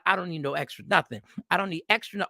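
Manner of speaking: ordinary talk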